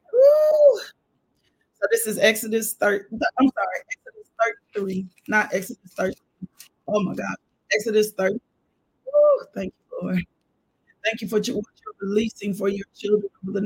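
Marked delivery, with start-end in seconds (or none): 0.1-0.8 loud high woo; preacher whooping in praise; joyful whoop. Real, not acted. Preacher's exultant shout. 9.1-9.5 loud high woo; preacher whooping in praise; joyful whoop. Real, not acted. Preacher's exultant shout.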